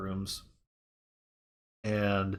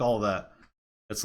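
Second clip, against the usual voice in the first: ordinary talk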